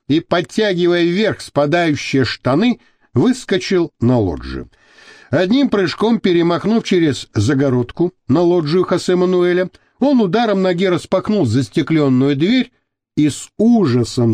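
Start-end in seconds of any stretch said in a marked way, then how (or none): none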